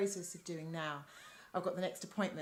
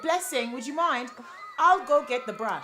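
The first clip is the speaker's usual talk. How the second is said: loud, raised call across the room; 4 semitones higher, 10 dB louder than usual. Acted. Receptionist calling a colleague to fetch a brush, loud call. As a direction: project it to a colleague far off.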